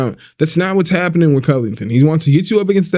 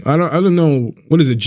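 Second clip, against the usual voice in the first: ordinary talk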